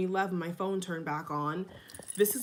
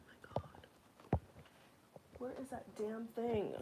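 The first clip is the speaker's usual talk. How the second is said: whisper; 2 semitones higher, 13 dB quieter than usual. Real, not acted. Shaken vlogger, under her breath.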